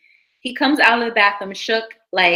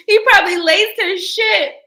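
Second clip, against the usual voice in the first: laughing; 11 semitones higher, 5 dB louder than usual. Real, not acted. Still laughing at the ex.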